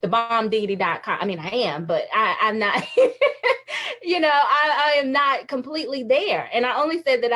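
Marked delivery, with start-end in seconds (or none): 2.9-4.0 high burst of laughter. Real, not acted. Laughs at own boast.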